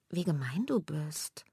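slow, hushed hurt whisper; little sister hurt by her sister's words; whispered outburst. Acted. Geflüstert, langsam und verletzt. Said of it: "stößt Mela hervor".

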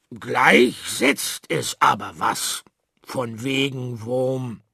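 a little worm playing the big brute; disguised menacing voice. A narrator's reading. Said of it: "Der Regenwurm verstellte seine Stimme". (verstellte bedrohliche Stimme)

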